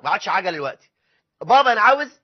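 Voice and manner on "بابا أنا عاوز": put-on demanding child. Child keeps demanding things.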